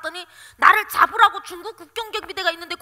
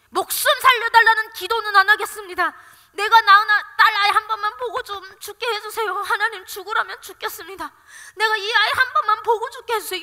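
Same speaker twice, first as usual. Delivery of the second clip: loud, pleading retold prayer; tearful prayer; 3 semitones higher, 7 dB louder than usual. Mother praying to see her child once, tearful pleading. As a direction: plead with God, raised and emotional. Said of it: "눈물이 나는 거예요".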